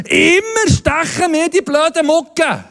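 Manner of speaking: high, slow mock grumble about the bugs; a hammed-up high, slow gripe; mock complaint. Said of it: "Oder wenn ich sage".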